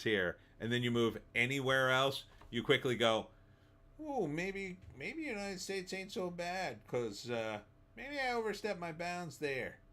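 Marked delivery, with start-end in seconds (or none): 4.0-9.8 high, soft, sheepish voice; regretful tax payer. Retold or imitated. An emigrant regretting Canada's taxes, high and sheepish. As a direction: sound like a guy quietly realizing his mistake. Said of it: "That was my accent of a guy who realizes he paid too much taxes".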